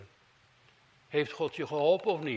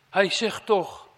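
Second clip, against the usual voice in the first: slow, much louder, "Hij" punched high; loud emphatic call; 5 semitones higher, 9 dB louder, slower than usual. Real, not acted. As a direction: announce it like a herald. Preacher introducing Jesus' words. (luid en nadrukkelijk)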